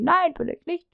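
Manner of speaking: a hammed-up employee snapping back; Peter's denial. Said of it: "dann sagt der Peter nämlich".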